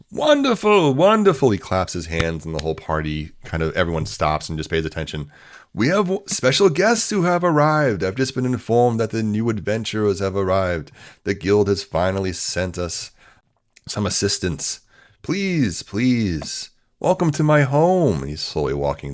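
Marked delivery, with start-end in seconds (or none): 0.1-1.5 high, loud, jubilant host; booming welcome, pitched way up; delighted exclamation. Acted. Host calls party to attention. 5.7-18.2 high, grand welcoming announcement; pitched-up, theatrical gentleman host; effusive party host. Acted.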